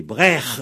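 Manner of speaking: "Breche" said brekh, rasped ending; "Breche" with throat kh, no sh; guttural form. Shown as a demonstration. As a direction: say "Breche" scraping the final sound. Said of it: "avec un re".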